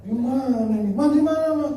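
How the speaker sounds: high, frantic calling out for his wife; panicked husband calling. Acted. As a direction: call out for her, lost and worried. Suami panik mencari istri di masjid. (suami panik memanggil istrinya dengan suara tinggi)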